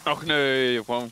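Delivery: ordinary delivery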